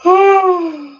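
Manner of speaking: loud, high falling sigh. Real, not acted. So tired during a chat, loud sighing. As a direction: let out a big high sigh.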